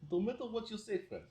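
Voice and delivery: high-pitched mock-Russian threat; Russian accent. Shown as a demonstration. Explaining to friend why Russian sounds mean, threatening Russian accent. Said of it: "the accent must sound like".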